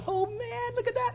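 very high, soft squeal; mock marvelling. Balaam rides off pleased with himself, mock marvelling. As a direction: gloat in a tiny high voice.

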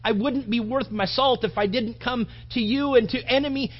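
usual speaking voice

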